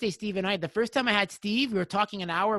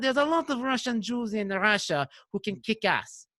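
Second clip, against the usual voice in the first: put-on heavy Russian accent; 3 semitones higher than usual. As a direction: do a butchered, thick Russian accent, stretching the words. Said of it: "in his heavy accent, I'm gonna butcher the accent".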